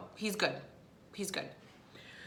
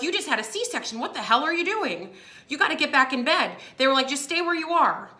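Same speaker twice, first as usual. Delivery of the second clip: imitating shocked nurses, pitched up; alarmed scolding; 5 semitones higher than usual. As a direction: scold the patient in mock alarm, voice raised in pitch. Nurses scolding her, retold.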